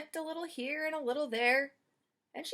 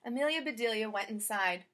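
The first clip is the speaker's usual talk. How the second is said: ordinary delivery, nothing marked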